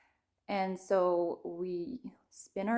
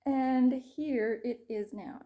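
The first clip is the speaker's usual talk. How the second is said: usual speaking voice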